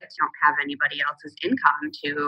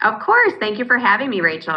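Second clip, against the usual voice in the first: bright greeting; 7 semitones higher than usual. Real, not acted. Guest's cheerful greeting.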